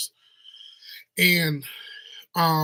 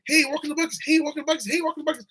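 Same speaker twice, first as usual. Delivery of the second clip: cheery greeter; 10 semitones higher, faster than usual. Retold or imitated. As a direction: belt the greeting like an overexcited store clerk. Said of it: "everyone's like".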